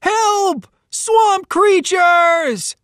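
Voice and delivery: high, loud, drawn-out panicked yell; terrified holler. Acted. Boys fleeing the swamp, hollering in terror. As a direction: holler for help as you run. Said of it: "the boys hollered".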